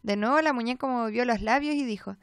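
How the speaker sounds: plain reading voice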